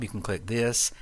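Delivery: ordinary talk, nothing marked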